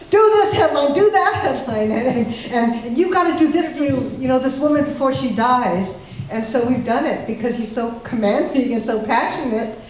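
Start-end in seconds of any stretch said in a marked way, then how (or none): 0.1-5.9 loud, high mock commands from an insistent boss; imitating shouted orders. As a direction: bellow orders like a demanding editor on the phone. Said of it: "much as he shouts into our ears".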